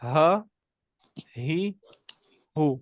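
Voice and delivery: ہا ہی ہو each breathed clearly with its own vowel; teacher modelling ha with three vowels; letter vowel demo. Shown as a demonstration. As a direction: model ha, hi, hu one by one. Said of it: "بس اس کو اس طرح پڑھیں گے".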